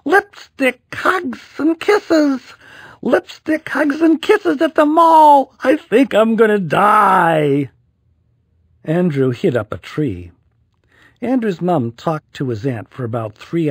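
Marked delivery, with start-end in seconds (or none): 0.0-7.7 high, loud despairing wail; yelling. A narrator's reading. Boy covered in lipstick, high despairing yell. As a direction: howl it in mock agony. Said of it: "yelled Andrew".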